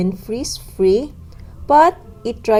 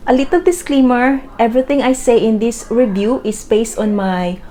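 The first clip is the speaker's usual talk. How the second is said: chirpy haul video; 5 dB louder than usual. Acted. Upbeat product review opening.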